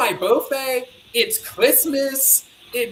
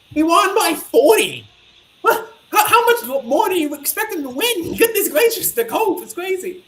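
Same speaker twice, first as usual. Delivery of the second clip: loud disbelieving outburst; exasperated, can't believe it; incredulous; 7 semitones higher, 4 dB louder than usual. Real, not acted.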